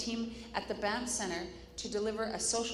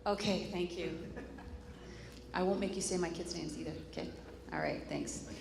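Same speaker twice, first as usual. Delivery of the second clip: ordinary talk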